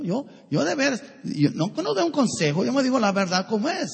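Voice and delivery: normal talking voice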